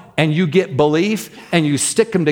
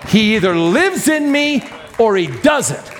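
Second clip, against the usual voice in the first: impassioned preaching; 7 semitones higher than usual. Real, not acted. Preacher tells congregation something has to change, impassioned preaching. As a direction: drive each clause home, building to the last word. Preacher building to climactic declaration.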